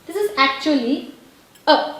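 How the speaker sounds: ordinary talk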